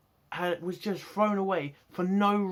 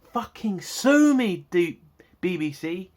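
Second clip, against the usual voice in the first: furious shout; 2 semitones higher, 9 dB louder, slower than usual. Real, not acted. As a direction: bellow it out slowly in defiance. Furious rant at the BBC.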